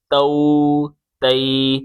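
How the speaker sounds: slow "تو تی", closing on clear oo and ee; maroof pronunciation. Shown as a demonstration. Quran teacher showing the correct short forms, maroof vowels. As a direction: say "تو" as "ta-oo", "تی" as "ta-ee", slowly. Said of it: "معروف پڑھیں گے اس پوری تختی کو".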